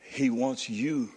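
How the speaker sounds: soft, slowed sermon with long pauses; hushed preaching. Real, not acted. Preacher telling listeners God wants to be found, hushed and slow. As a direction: let it sink to a tender hush.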